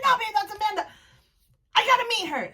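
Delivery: high, starstruck squeal; excited insisting. Retold or imitated. Fan telling babe she must meet Amanda, excited. Reenacts excited reply to companion.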